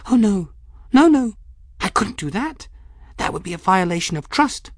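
high-pitched, flustered protest; alarmed refusal. Acted. Folly refusing to lend her jar, alarmed. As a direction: burst out high, then protest anxiously.

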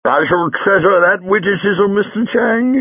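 high-pitched, laughing delivery; tickled, voice climbing into laughter; chuckling. Acted. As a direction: squeal it through helpless chuckles. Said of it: "Jago chuckled".

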